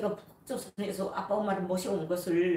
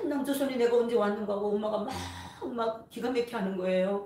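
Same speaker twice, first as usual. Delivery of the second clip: usual speaking voice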